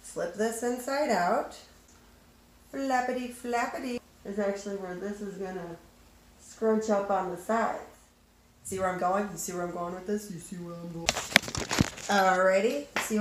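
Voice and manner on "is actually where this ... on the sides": muffled behind fabric mask; speaking with face covered; through a cloth mask. Real, not acted. Seen 0: Dark fabric mask covers nose and mouth throughout the labelled words.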